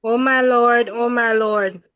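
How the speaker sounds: normal talking voice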